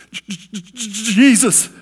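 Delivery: a strained, high choked-up gasp of a name. Real, not acted. Husband overcome in bed, choked-up cry to God. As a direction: barely get the word out through tears. And I couldn't talk.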